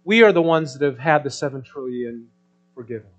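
loud, high fervent preaching; preaching at full force. Acted. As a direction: drive the point home to the congregation. Preaching the point.

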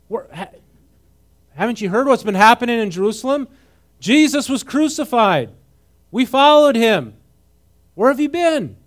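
high, loud, incredulous "come on, dude"; loud, high mock disbelief at a clueless stranger; exasperated casual dude. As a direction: act stunned that he hasn't heard. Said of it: "That's what he's saying".

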